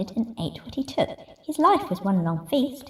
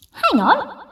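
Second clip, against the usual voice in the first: very high, louder quacky call; quacking duck; 13 semitones higher, 4 dB louder than usual. Acted. Duck stopping the rat with a high quacky cry. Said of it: "quacked the duck".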